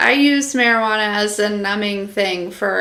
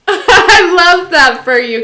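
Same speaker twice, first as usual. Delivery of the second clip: loud, higher, laughing through the words; 7 semitones higher, 7 dB louder, slower than usual. Real, not acted. Streamer gushing at a viewer's news, laughing loudly. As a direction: gush while laughing.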